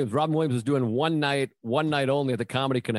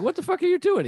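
much higher, hammed-up imitation of his friend; friend's mock outrage, pitched way up; imitating Lamont. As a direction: squeal it out like his buddy scolding him.

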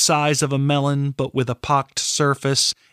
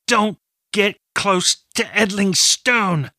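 high, straining warning; through gritted teeth; 8 semitones higher than usual. Acted. Draining Katrin warns Prios off the stone, teeth gritted. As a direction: force each word out through clenched teeth. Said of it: "she said through gritted teeth".

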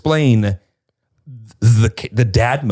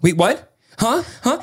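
mock freaking out; 11 semitones higher, slower than usual. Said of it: "she was starting to freak out".

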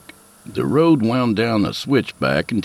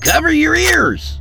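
high, loud holler; hollered warning; 4 dB louder than usual. A narrator's reading. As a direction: yell a warning over the gun. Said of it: "he hollered".

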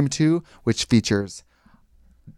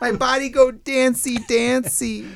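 high, slow sing-song echo; mock cutesy voice; 11 semitones higher, slower than usual. Friend echoing a silly phrase in a high squeal. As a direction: squeal the phrase back teasingly.

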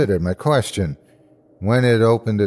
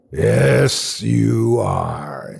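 rasping creature voice; rasped; much slower than usual. Acted. The thing rasping back at the narrator. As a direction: scrape it out of the throat. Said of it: "it rasped".

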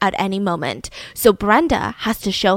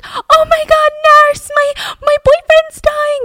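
very high, loud retold scream for help; hammed-up hysterical cry, last words drawn out; panicked screaming; 6 dB louder, slower than usual. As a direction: shriek for a nurse in mock panic. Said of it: "she's screaming".

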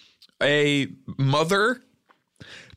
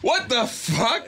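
shocked; 10 semitones higher, slower than usual. Acted. Mock surprise at guest.

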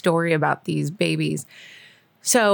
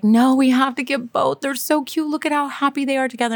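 pleading; 5 semitones higher than usual. Retold or imitated. Pleading with husband.

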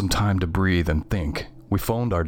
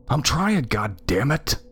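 mildly hissed, pitched-up comeback; hissed retort; 7 semitones higher, slower than usual. A narrator's reading. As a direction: hiss back in frustration, starting high. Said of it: "I hissed".

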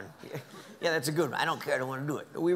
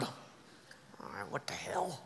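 whisper; 10 semitones lower, 11 dB quieter than usual. Real, not acted. Giving in under his breath.

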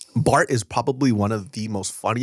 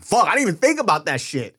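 dawning realisation; 8 semitones higher, 3 dB louder than usual. Retold or imitated. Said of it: "I'm like".

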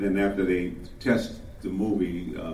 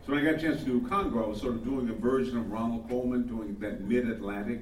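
ordinary talk, nothing marked

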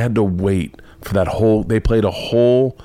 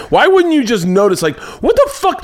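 high, loud incredulous outburst; exasperated; 11 semitones higher, 3 dB louder than usual. Real, not acted. Comic ranting about his oblivious mom, high and loud. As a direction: let the disbelief burst out high and loud. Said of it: "exasperated rant".

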